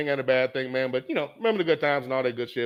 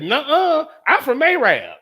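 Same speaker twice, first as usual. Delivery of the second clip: higher, louder sassy woman's retort; mock indignant woman; 9 semitones higher, 5 dB louder, slower than usual. Woman in the stands snapping back at Pop, mock indignant. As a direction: throw it back at him like an offended fan.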